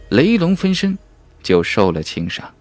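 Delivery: ordinary delivery, nothing marked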